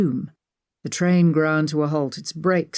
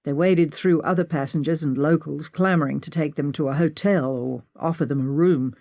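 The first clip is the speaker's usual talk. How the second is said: ordinary delivery, nothing marked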